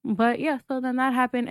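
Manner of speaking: ordinary talk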